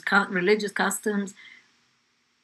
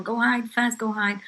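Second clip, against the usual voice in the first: higher, louder urgent order; urgent warning; 4 semitones higher, 6 dB louder than usual. Retold or imitated. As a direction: warn them to hide at once. Lookout warns children to hide.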